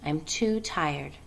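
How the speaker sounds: soft, slow and tired; a low voice too tired to go on. Acted. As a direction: say it low, worn out, barely wanting to talk. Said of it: "she said in a low voice".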